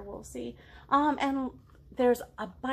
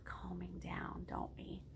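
whispered, slow and hushed; soft whisper; 4 semitones lower, 14 dB quieter, slower than usual. Shown as a demonstration. Teacher whispering to young listeners about calming down. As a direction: hush it down to calm the kids.

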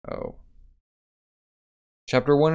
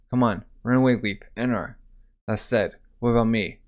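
plain reading voice

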